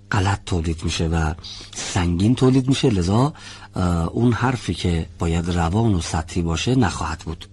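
ordinary delivery

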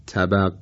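plain light T in «طبق», like تبق; ط مثل ت. Shown as a demonstration. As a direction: say «طبق» with an ordinary thin ت, no velarization. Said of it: "با ته دو نقطه به عنوان مثال بخوایم تلفظ کنیم". («طبق» با تای نازک دو نقطه)

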